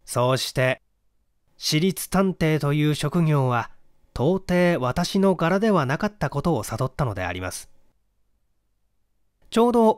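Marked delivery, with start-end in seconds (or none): none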